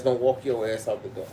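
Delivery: ordinary talk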